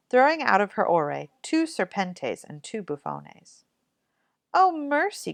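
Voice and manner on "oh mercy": high, horrified cry of mercy. Acted. Mother seeing snakes and toads fall from her daughter's mouth, horrified cry. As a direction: cry out in shock. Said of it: "cried mater".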